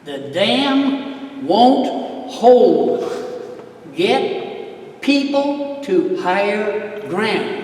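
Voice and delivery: put-on very slow, word by word, like a kid sounding it out; slow halting reading. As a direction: read it haltingly as if just learning. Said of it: "my reading was coming along".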